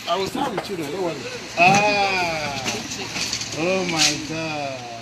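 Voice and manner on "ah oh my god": louder, drawn-out oh my God; exclaiming in amazement to the congregation; amazed exclamation. Real, not acted. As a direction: stretch it out in wonder. Pastor reacts to child's answer.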